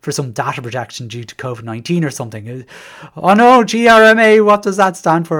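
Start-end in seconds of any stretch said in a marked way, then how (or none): 3.2-5.4 mock alarm, higher and louder; panicked principal. Principal reacting to the GRMA announcement, mock panic. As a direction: play a flustered principal dreading new paperwork. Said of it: "some of them may have thought".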